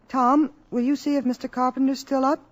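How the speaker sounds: usual speaking voice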